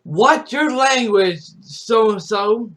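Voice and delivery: a mother catching her son cursing; mom scolding. Retold or imitated. Mom scolds her son on stream, mock scolding. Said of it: "she'll be like".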